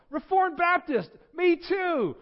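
very high, loud jubilant yell; drawn-out, over-the-top celebration; ecstatic agreement. Acted. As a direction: shriek with joy at the match. Preacher's excitement peaks at match.

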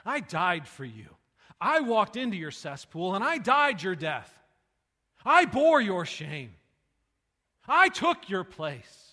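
slow, loud, weighty proclamation; preaching at full force. Acted. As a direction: hammer each short line slowly. Preaching, voicing Jesus.

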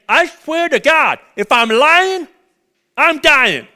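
loud, high desperate oath; Peter swearing. Acted. Peter swearing to the crowd, loud desperate denial. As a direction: swear it like a cornered man.

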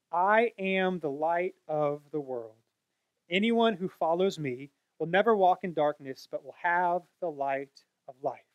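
slow, solemn reading of Jesus' words; measured scripture reading. Acted. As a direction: read it out slowly like scripture. Preacher reads Jesus' words.

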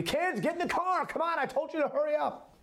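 put-on high, sharp, impatient parent hurrying the kids; mock irritated parent hustling kids into the car.

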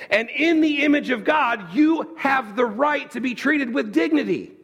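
rising to a shouted climax; preaching climax. Real, not acted. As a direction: shout it to the back row. Preacher peaks on dignity.

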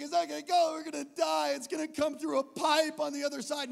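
high, loud frantic outburst; demonic panic. Retold or imitated. Kid in the dark tunnel, panicking. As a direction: imitate a kid working himself into hysteria. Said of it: "this demonic panic".

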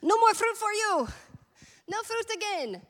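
Jesus as a sitcom soup seller, high loud shout; mock foreign accent. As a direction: bellow it high in a silly, unplaceable accent. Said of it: "don't even know what that accent is".

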